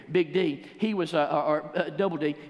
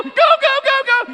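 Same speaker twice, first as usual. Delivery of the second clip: very high, loud stadium yell; fan yelling go; 15 dB louder than usual. Retold or imitated. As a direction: scream it from the stands. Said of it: "And I go".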